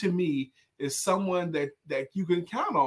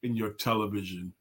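mock deep-voiced order; 6 semitones lower, 5 dB quieter than usual. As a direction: lower and softer, slowed. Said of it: "When he goes in the Subway sandwich".

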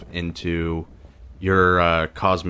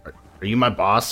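ordinary talk, nothing marked

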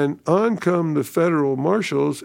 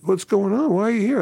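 puzzled question; 8 semitones higher, faster than usual. Retold or imitated. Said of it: "And he says".

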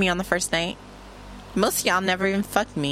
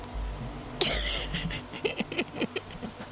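breathless laugh; 9 semitones higher, 9 dB quieter than usual. Real, not acted. Laughs after finding box.

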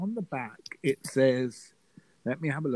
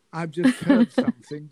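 laughing; 2 semitones higher, 7 dB louder than usual. Real, not acted. Laughing while announcing news.

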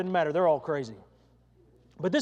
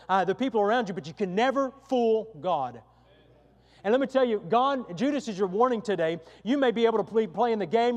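louder, higher preacher's warning; full-force preaching; 5 semitones higher, 7 dB louder than usual. Acted. Preacher warning churchgoers who play the game, loud and insistent. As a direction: drive the warning home to the congregation.

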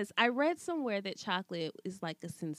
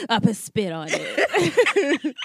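laughing through the words; 8 dB louder, much slower than usual. Real, not acted. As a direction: say it while cracking up. Laughing mock disgust at chocolate.

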